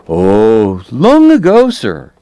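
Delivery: a big man's piping voice trailing off. Acted. Hoover's high voice continues.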